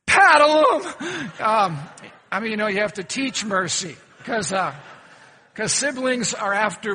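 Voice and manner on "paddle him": put-on loud, slow, drawn-out yell of a vengeful kid; kid's eager yell. Kid yells at parent to punish his brother, loud and slow. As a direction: shout it like a sibling out for blood. Child answers parent in preacher's story.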